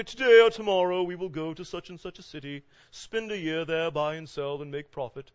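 put-on accent, loud start easing off; Bryson Miller accent. Preacher pretending to be someone for the verse, put-on accent. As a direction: proclaim it in an imitated accent, slowing midway. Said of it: "pretend you're Bryson Miller for a second and get your, your accent like this".

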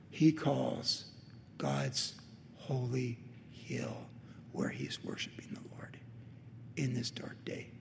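very soft, sinking into near-whisper; slow it right down, almost whispered; hushed reverent preaching. Acted. Preacher on David worshiping in grief, hushed reverence. Hushed climax of the hill passage.

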